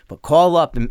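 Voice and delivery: normal talking voice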